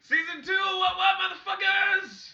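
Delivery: loud hyped-up yell; hype shout. Real, not acted. Hyping the new season.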